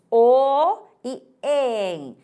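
vowel pairs drawn out one by one; slow spelled-out vowels. Shown as a demonstration. Teacher to students, spelling out vowel pairs slowly. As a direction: spell the sounds out slowly for the class. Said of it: "esses encontros".

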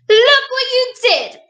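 put-on high, loud queen telling off a child; storybook queen shouting an accusation; scolding shout. A narrator's reading. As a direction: scold loudly, voice pitched right up. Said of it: "shouted the queen, pointing to the muddy floor".

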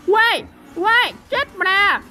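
high, excited yelps. Real, not acted. Streamer reacts to a surprise kill, high excited yelps. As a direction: react thrilled at a sudden kill.

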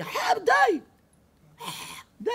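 very high, loud angry yell; high shrieking mock anger; mock shout. Neighbor yelling at speaker.

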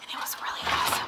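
hushed late-night whisper. Real, not acted. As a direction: whisper so nobody wakes up. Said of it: "We have to be quiet".

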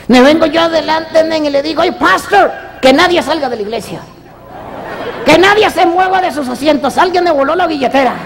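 all worked up over the stolen wallet. Acted. [name] telling the preacher how his wallet got stolen.

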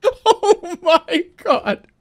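long, high, loud appalled cry; reeling, drawn-out outburst; shocked. Real, not acted. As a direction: stretch it out high in horrified disbelief. Shocked at a gross sound.

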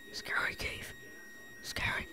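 breathless scared whisper; spooky whisper. Pretend. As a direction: whisper as if hiding from it. Spooky whisper about cave.

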